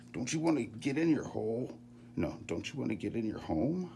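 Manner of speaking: high playful quoted movie voice; quoting a movie. Retold or imitated. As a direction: coax it in a cartoonish voice.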